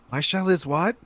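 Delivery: high, loud puzzled question; loud, clueless query; oblivious character. Acted. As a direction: shout back, not having caught it. Doc loudly fails to hear warning.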